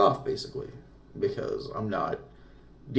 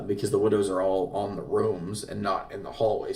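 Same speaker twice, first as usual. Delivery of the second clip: ordinary talk, nothing marked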